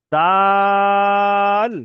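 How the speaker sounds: দাল held very long and loud, vowel stretched; drawn-out dal. Shown as a demonstration. Teacher drawing out দাল for Arabic letter learners. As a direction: stretch দাল slowly like reciting a letter name. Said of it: "দুটো অক্ষর একই রকম দেখতে".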